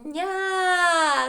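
НЯ called out high and loud with a long open а; open vowel in НЯ. Shown as a demonstration. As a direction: open wide on a ringing «Ня!». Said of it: "Рот широко відкрився". («Ня!» голосно, високо, з широко відкритим а)

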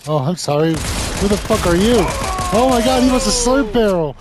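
loud, high startled yelling; screaming after a jump scare; shocked yelling. Real, not acted. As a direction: yell in real panic at the ambush. Ambushed in game, yelling in shock.

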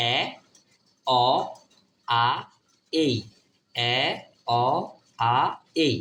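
এ, অ, আ, এই drilled with pauses between; four vowel sounds. Shown as a demonstration. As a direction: recite এ, অ, আ, এই separately, twice. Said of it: "এই চার ধরনের উচ্চারণ পাবেন". (এ, অ, আ, এই থেমে থেমে উচ্চারণ)

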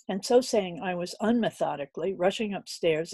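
ordinary delivery

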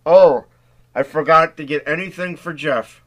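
talking through a mouthful; words round a bite of brownie; mouth full. A narrator's reading. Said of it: "with a full mouth".

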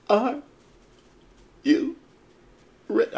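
high, loud isolated words; catchphrase call-out. Pretend. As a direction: punch out each word with long gaps. Attempting wrestler's catchphrase.

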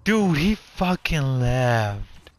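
slow, drawn-out disbelief; stunned and dragging the words. Real, not acted. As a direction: stretch every word out, dumbfounded. Opponent suddenly quit the game.

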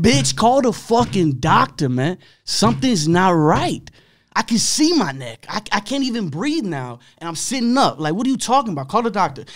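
furious yell. Retold or imitated. Patient screaming at the nurse, high furious yell. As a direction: yell at her to call the doctor. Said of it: "I said".

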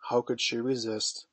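ordinary delivery, nothing marked

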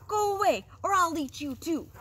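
loud, high troll threat; thundering troll. A narrator's reading. Troll sends middle goat off, high thundering voice. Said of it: "thundered the troll".

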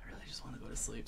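tired whisper. Retold or imitated. Geralt wishing for sleep, rushed whisper. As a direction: whisper it out fast like a sleepless man. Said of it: "so he's like".

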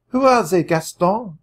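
a French woman calling from the doorway, high and loud. A narrator's reading.

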